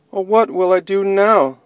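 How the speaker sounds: lost bunny fretting aloud, a touch of sadness; sad bunny. Acted. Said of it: "the sad bunny wondered".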